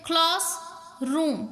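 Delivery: "Class ... रूम" with a clear break between; split into syllables. Shown as a demonstration. Teacher showing syllabication, "class. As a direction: say "class", pause, then "रूम". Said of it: "इनमें भी सिलेबिकेशन होती है".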